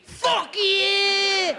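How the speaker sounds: high, loud, drawn-out Glaswegian shout; mock-furious high holler; hostile yell. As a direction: scream it like a furious Glasgow kid. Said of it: "Even his freckles were pointing at me".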